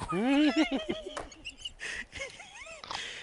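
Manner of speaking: long, squealing high laugh trailing on; high-pitched laugh. Real, not acted. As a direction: crack up in a high register. Laughs at his retold exchange.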